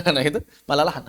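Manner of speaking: normal talking voice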